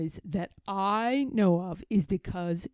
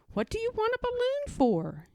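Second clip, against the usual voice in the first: put-on much higher, light child voice; boy's voice; 14 semitones higher than usual. A narrator's reading. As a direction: ask like a curious little boy. Christopher Robin asks.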